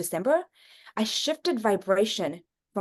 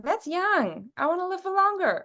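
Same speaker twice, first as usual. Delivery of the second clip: mock protest; 10 semitones higher than usual. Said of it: "I was like".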